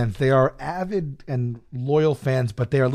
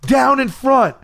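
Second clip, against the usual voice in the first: high mock yell; imitated yelling; 8 semitones higher, much slower than usual. Concertgoers to standing dancers, imitated yelling. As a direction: yell like an annoyed crowd behind. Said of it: "people start yelling at us".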